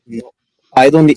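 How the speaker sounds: normal talking voice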